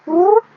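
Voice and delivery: high, loud "whoop" of zooming past; whoosh noise. Retold or imitated. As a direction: imitate someone whizzing by. Imitates someone zooming past.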